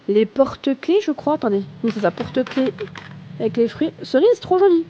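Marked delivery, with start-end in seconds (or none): 4.0-4.9 high excited squeal; delighted gush. Real, not acted. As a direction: gush over something cute. Delighted by cute keyrings.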